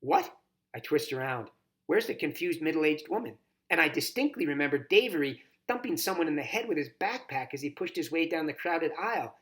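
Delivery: ordinary delivery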